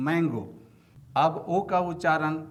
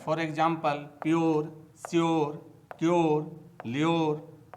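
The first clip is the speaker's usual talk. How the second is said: normal talking voice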